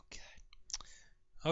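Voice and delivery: breathed God under the breath; whispered. Real, not acted. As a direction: whisper it in exasperation. Exasperated aside.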